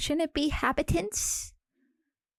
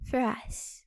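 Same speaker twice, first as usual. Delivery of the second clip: whisper; 5 dB quieter than usual. Pretend. Whispered end of comfort.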